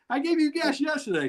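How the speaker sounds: high, laughing wife's retort; mock exasperated wife. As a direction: scold him as the wife, laughing. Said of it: "wife's reply".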